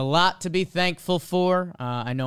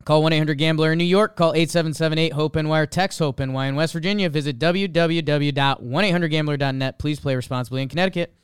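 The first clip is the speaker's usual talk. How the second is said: rapid-fire fine print; fast legal disclaimer; faster than usual. Acted. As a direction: rattle off the small print.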